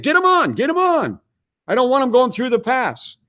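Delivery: very high, pressing retold demand; urgent insistence. Traveller pressing the operator to radio the captain, urgent and high-pitched. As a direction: repeat the order high and pressing.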